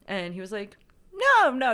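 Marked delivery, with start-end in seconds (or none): 1.1-1.7 high, loud no no; hearty reassurance. Retold or imitated. The doctor waves off her fear of contagion, high and loud. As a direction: wave the worry off.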